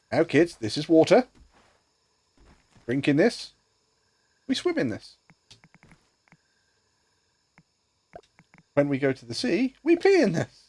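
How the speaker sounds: mock teacher talking to kids; sing-song classroom explainer.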